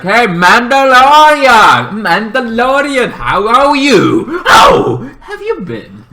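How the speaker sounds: high, loud, slow mock hail with a laugh; hammed-up excited greeting; cheery shouted greeting. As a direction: call out to an old friend, overjoyed. Queen greets the Mandalorian.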